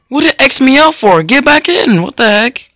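sudden high indignant protest; outraged surprise. Real, not acted. Kicked out of the game, a high startled protest. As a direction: yelp in disbelief at being kicked.

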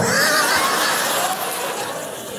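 high breathy shouted laugh. Real, not acted. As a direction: burst out laughing. Laughing at Allahu Akbar joke.

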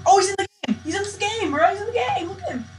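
high-pitched, excited exclaiming; thrilled discovery. Real, not acted. Gamer finds lost friend in game, thrilled. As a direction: squeal with delight at spotting a friend.

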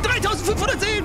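Dreitausendfünfhundert Seelen screamed high and loud; anguished scream. Real, not acted. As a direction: wail Dreitausendfünfhundert Seelen in despair. Same words said plainly earlier.

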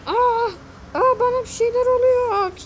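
high, loud, startled shriek that turns into a wail; a loud, high scream of shock; panicked scream. Acted. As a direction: shriek as if something is happening to your body. Character transforming, screams.

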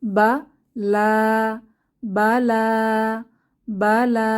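বা লা sounded out slowly, then repeated; syllable by syllable. Shown as a demonstration. Quran teacher spelling a word for students, slow. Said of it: "teacher spelling Quran word".

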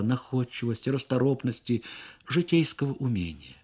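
plain reading voice

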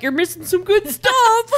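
high, loud teasing call through laughter; giggling imitation of a partner calling out; laughing mock call. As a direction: tease her, laughing. Voicing partner while laughing.

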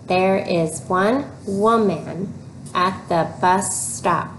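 slow, even reading with the class; slow choral reading. Shown as a demonstration. Teacher reads together with the children, slowly. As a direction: read along slowly, word by word. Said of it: "Read it with me".

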